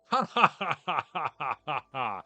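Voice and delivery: slow, loud hammy cackle; evil villain laugh. Acted. Streamer voicing the owner's mocking laugh, slow evil cackle.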